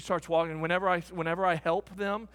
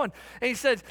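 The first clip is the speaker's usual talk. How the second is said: usual speaking voice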